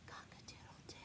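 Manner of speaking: a tiny breathless whisper of a crow. Acted. Rooster losing his voice, whispered crow. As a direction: rooster trying to crow but only whispers.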